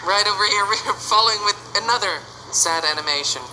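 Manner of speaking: ordinary talk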